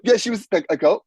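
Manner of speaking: normal talking voice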